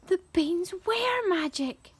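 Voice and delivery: high, amazed exclamation; excited cry. A narrator's reading. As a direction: cry out in wonder, pitch jumping up. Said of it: "Jack cried".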